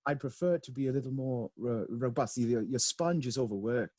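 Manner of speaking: British baking judge giving a verdict; Paul Hollywood. Acted. Co-host imitating a judge criticizing a sponge, British accent. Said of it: "Is that your Paul Hollywood?".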